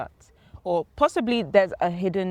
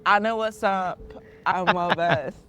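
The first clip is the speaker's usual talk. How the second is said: put-on Jamaican accent; 5 semitones higher, 5 dB louder than usual. Hamming up a proud Jamaican persona.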